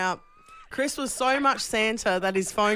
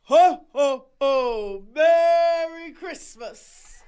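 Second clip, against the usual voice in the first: booming slow Santa, from the toes; 5 semitones higher, much slower than usual. Acted. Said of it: "I'm gonna really go from the toes".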